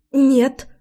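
quick blurted no, forced smile. A narrator's reading. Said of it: "выпалила Лой и натянуто улыбнулась".

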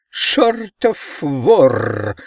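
high, very slow creaking buzz; buzzy creaking voice. A narrator's reading. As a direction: creak it out like a giant insect. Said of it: "проскрипел он своим резким жужжащим голосом".

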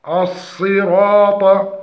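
"الصراط" with full emphatic sad, ra and ta; heavy ra and ta. Shown as a demonstration. Teacher models the right word, heavy letters in الصراط. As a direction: slowly thicken the r and t of "الصراط". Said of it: "وتفخيم الراء والطاء".

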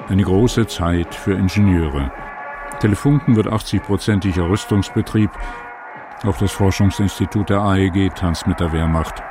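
ordinary talk, nothing marked

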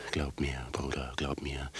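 hushed plea. Acted. Pleading with brother.

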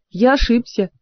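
ordinary delivery, nothing marked

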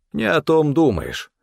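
high, curt rebuke; sharp interruption. A narrator's reading. Stefan cuts off his partner's questions, sharply. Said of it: "резко оборвал он меня".

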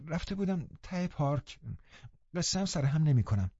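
mildly falter, caught out; hesitant stammer. Acted. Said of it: "با من و من گفت". (با کمی من و من و صدای آهسته)

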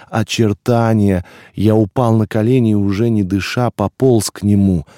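ordinary delivery

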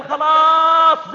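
loud, high, drawn-out rude yell; rude shout. Retold or imitated. Son yelling back at his father, loud and rude. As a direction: snap back at your father.

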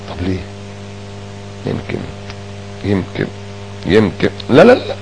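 low, hushed inner doubt; brooding to oneself. Retold or imitated. A slighted man brooding over his suspicion, hushed and low. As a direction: mutter the suspicion to yourself.

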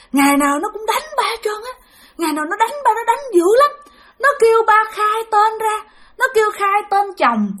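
high, plaintive wail of a tormented spirit; pained complaint. Retold or imitated. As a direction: recount beatings as a suffering victim. Spirit tells of daily beatings.

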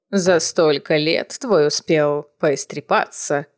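grandmother voice. A narrator's reading. Grandmother speaks to Manon.

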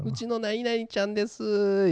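high, slow proud owner voice; proud pet owner. Retold or imitated. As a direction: show off your pet sweetly. Pet owner introducing cat.

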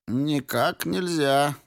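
put-on a stern old secretary objecting; very old man. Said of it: "посерьёзнел старый секретарь".

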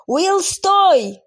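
high, loud scream of a name; desperate shout. Acted. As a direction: yell after him in panic. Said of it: "закричала девушка".

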